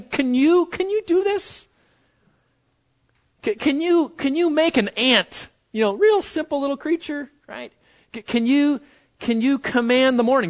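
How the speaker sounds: rhetorical challenge. Acted. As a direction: dare them to try. Challenging the congregation.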